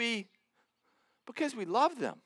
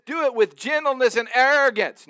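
louder, higher, hammed-up wrong version; mock misquote; 5 semitones higher, 9 dB louder than usual. Shown as a demonstration. Preacher misquoting the verse to his congregation, hammed-up and loud. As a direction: belt out the wrong word as a joke. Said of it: "No, he doesn't say that".

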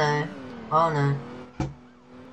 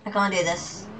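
ordinary talk

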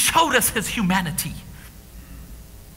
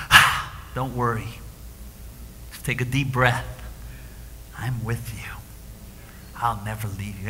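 much softer and lower, whispered reassurance; gentle voice of God; 12 semitones lower, 7 dB quieter than usual. Acted. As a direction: breathe the words like a comforting hush. God speaking gently to the frightened.

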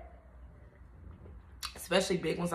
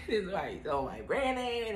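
laughing; 4 semitones higher, 4 dB quieter than usual. Retold or imitated. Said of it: "y'all be feeling like".

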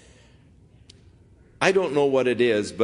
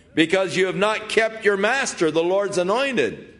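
yelling; 8 semitones higher than usual. Acted. David shouting rebuke at Abner across the distance. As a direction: call out the accusation across the valley. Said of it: "He started yelling".

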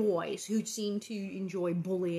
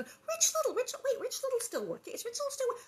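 amazed fans. Retold or imitated. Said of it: "'cause the people go".

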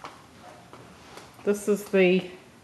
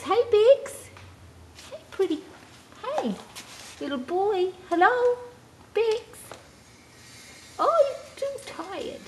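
much higher, soft cooing; 13 semitones higher, 3 dB quieter than usual. Real, not acted. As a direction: coo over a sleepy puppy. Cooing to the new puppy.

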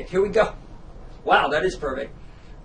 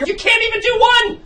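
loud, high snap; angry shout; 12 semitones higher, 4 dB louder than usual. Acted. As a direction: cut her down hard. Director snapping at actress.

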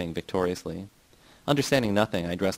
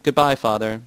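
higher, louder farewell call; shouting; 4 semitones higher, 7 dB louder than usual. A narrator's reading. Jan walking away from his father, raised shout. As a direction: call it out as you leave. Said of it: "Jan shouted".

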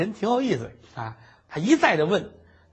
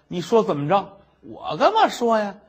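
usual speaking voice

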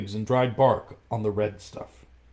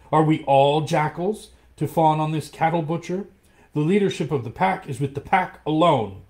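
higher and louder, scornful; angry defiance; 7 semitones higher, 7 dB louder than usual. Acted. Mowgli scorns the wolves before the tiger, loud and angry. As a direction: shame the pack into standing up. Boy rouses the pack.

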